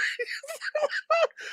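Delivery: high crying cackle; shrieking laugh. Real, not acted. Host cracking up at the chat, shrieking laugh. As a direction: let out a high wheezing laugh.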